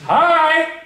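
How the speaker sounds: high loud flirty call; ladies calling.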